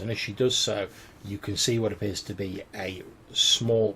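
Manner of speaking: normal talking voice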